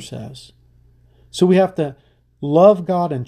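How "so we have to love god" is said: rising preaching. Real, not acted. Preacher urging the congregation.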